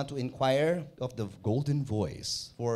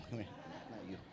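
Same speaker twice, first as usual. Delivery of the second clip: ordinary delivery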